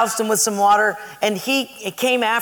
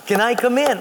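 higher-pitched request through a door; pleading roommate; 5 semitones higher than usual. Retold or imitated. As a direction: imitate a friend asking to be let in. Mike voiced through the door.

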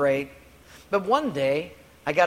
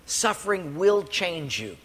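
"Suffering will change you" slowed right down for weight; emphatic repetition; slower than usual. Shown as a demonstration. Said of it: "Let me say it again".

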